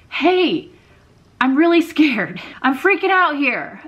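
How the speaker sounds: high, loud panicky inner voice; mock panic, pushed up high and loud; freaking out. Anxiety manager alerting its owner, freaking out. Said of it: "That manager is saying".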